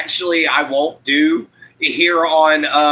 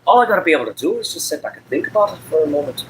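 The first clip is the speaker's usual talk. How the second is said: lilting Irish brogue; chatty Irish lilt; Irish accent; faster than usual. Shown as a demonstration. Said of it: "I can pull off an Irish accent".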